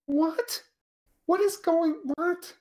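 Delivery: high, baffled "what?" in mock confusion; mock bafflement. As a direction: play utterly lost, unable to follow the speech. Baffled by unintelligible accents.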